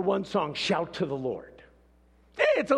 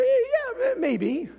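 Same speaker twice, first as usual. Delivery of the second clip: high-pitched, doubtful yeah; doubtful concession; slower than usual. Acted. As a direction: concede it in a raised, unconvinced voice. Doubting reply to culture excuse.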